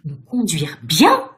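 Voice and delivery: loud, drawn-out outraged question; indignant cry. A narrator's reading. Ghost leaping from his chair at Virginia, indignant cry. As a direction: cry it out in disbelief. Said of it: "cria-t-il".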